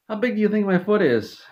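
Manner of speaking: high, lightly voiced boss asking; high put-on voice for the foot question; high mock Todd Howard. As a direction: pitch it up like a smug little man. Todd Howard asks, leg lifted.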